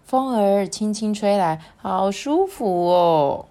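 put-on slow, lilting read-aloud for children; storybook narration. A narrator's reading. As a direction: draw young listeners into the story, unhurried.

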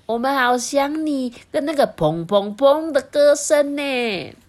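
slow, high, pleading small walrus; little walrus voice. Acted. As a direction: call out longingly like a tiny walrus. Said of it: "最小的海象就说".

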